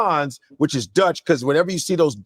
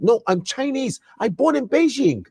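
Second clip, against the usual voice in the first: put-on Chinese accent; 6 semitones higher than usual. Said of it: "and I had the accent".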